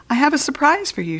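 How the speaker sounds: high, fast and bright teacher's news; cheerful announcement. A narrator's reading. Teacher greets the returning class with news, quick and high. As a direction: tease the surprise to the class. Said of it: "greeted them with a smile".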